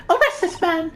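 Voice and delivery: shrill-high accusing lady, well above his own voice; high-pitched Pavlova. Acted. Pavlova accusing the intruder in a high-pitched voice. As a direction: shriek the accusation in a high put-on voice. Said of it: "the really high-pitched voice".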